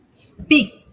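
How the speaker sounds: ordinary talk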